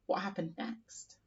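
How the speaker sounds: soft whispered question; hushed storyteller asking what came next; suspense whisper. Shown as a demonstration.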